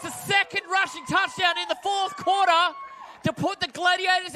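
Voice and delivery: very high, climbing touchdown shout; excited play-by-play. Real, not acted. Commentator calls a go-ahead rushing touchdown, excited shout. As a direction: build from chase to full-voiced celebration.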